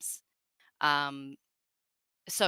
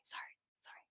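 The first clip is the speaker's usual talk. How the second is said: whispered apology; 18 dB quieter, faster than usual. Retold or imitated. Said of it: "and he was always like".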